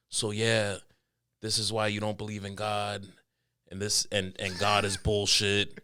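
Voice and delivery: low, soft teacher mid-lecture; lecturing teacher. Retold or imitated. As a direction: quietly imitate a teacher's matter-of-fact lecture. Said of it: "the teacher was, like, in the middle of giving a lecture".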